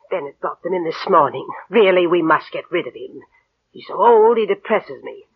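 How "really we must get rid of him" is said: ordinary delivery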